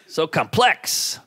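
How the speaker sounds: slow, drawn-out mock wonder; slow teasing marvel; mock awe. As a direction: stretch it out in fake amazement. Mock admiration.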